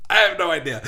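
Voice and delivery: laughing through the words; amused, breaking into laughter. Real, not acted. Reacting to a viewer's comment, laughing.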